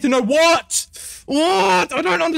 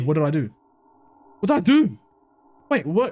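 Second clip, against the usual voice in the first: ordinary delivery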